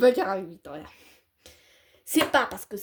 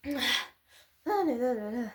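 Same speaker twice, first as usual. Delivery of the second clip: exasperated; much slower than usual. Acted. Exasperated sigh-like lament.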